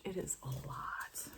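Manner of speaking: breathy aside to herself; whisper. Real, not acted. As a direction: confide it under your breath. Whispered aside about the pattern.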